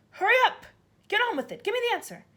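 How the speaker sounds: furious bark. A narrator's reading. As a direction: hound the boy for the answer. Trunchbull barks at Wilfred.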